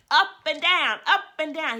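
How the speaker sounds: mother imitating her toddler's loud, high yell; son's yell. Mother imitating her son yelling on her lap, loud and high. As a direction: belt it out high like an excited little boy bouncing. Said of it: "and he'd yell".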